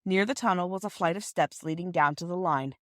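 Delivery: plain reading voice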